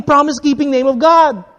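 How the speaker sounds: ordinary talk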